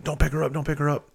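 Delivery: high, urgent warning to stop him; alarmed wife. Retold or imitated. Wife panicking over injured child.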